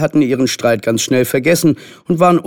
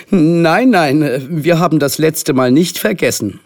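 emphatic, raised-voice refusal; called out; 6 semitones higher, 3 dB louder than usual. A narrator's reading. Mothers remembering the last sleepover, emphatic refusal. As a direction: protest loudly, higher than usual. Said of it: "riefen beide Mütter im Chor".

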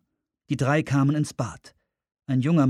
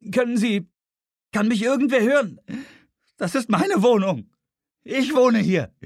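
hohes, lautes Rufen; ruf, damit dich endlich jemand hört; verzweifelter Protest; 12 semitones higher, 5 dB louder than usual. Acted. Ignored tenant protests desperately. (verzweifelt rufend, hoch und laut)

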